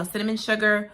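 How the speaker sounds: usual speaking voice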